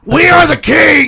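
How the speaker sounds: triumphant shout. Acted. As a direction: yell it like a battle cry. Podcast chant, shouting name.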